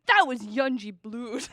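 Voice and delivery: lightly voiced earnest radio DJ; mock-serious DJ. Imitating the DJ's straight-faced announcement, mock serious. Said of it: "He does it so seriously".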